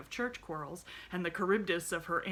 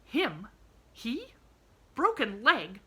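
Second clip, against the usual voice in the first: louder, higher, bewildered questions; stunned aunt demanding an answer; aunt shocked to hear of a stranger's broken leg; astonished; 6 semitones higher, 13 dB louder than usual. A narrator's reading. Aunt baffled by the news.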